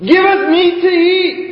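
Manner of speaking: high, whiny mock crowd voice; mock whining crowd. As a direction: wail it like a spoiled crowd begging. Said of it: "They keep wailing to me".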